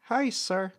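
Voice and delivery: put-on high little-boy voice; a lightly voiced young boy; shy child greeting. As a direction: greet him like a small polite kid. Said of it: "He waves and goes".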